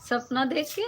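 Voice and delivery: high, loud surprised question; a sudden "what for?", genuinely taken aback. Real, not acted. Host reacts to a viewer laughing, surprised and loud.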